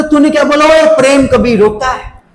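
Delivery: mock annoyed retort; mock angry teacher. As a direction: play the teacher getting riled up. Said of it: "Prem sir को भी थोड़ी उतरी".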